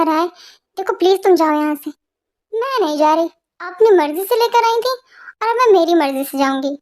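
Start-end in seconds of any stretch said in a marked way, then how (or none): none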